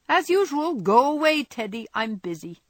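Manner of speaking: high, loud brisk mock of Jo; a playful take-off of Jo brushing him off; imitating Jo. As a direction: toss it off in Jo's impatient voice. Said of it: "What would Jo say".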